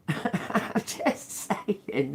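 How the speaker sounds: laughing through the words. Real, not acted. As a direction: say it while laughing. Laughing aside.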